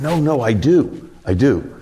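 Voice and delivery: mildly acted, drawn-out protest; retold insistence. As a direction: act out someone earnestly insisting.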